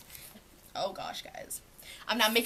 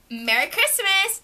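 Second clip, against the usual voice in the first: cheery greeting; 10 semitones higher, 6 dB louder than usual. Retold or imitated. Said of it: "she's like".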